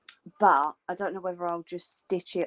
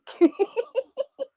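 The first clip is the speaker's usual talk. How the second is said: high laugh; 4 dB louder than usual. Real, not acted.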